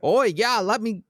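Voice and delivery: a bad English accent slipping; mock English accent. Host slips out of his mock English accent. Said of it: "wait, I just switched voices".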